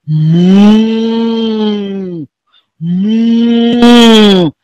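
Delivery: long, drawn-out moo; low like a cow, holding the sound. Shown as a demonstration. Teacher shows pupils what a cow says, mooing. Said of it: "Everybody moo like a cow".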